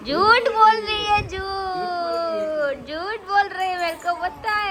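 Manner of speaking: tearful, high, loud accusation; crying protest. Real, not acted. Child crying at the adult over who built the house, tearful protest. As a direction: sob out the accusation of lying.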